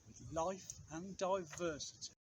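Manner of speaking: usual speaking voice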